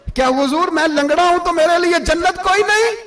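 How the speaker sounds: high, climbing, plaintive question; impassioned plea. Retold or imitated. Lame companion asking the Prophet about paradise, impassioned plea. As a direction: plead upward as a preacher acting the part.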